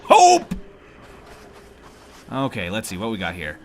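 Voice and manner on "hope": loud, high, joyful cry; excited. Real, not acted. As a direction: shout it out with sudden hope. Finds island with flint.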